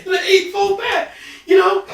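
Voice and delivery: high mock sing-song; playful squeak. Playful high aside after laugh.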